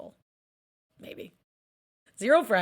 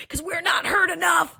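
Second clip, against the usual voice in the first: louder, higher passionate push; 8 semitones higher, 5 dB louder than usual. Real, not acted. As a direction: insist on being heard. Passionate about intersex rights.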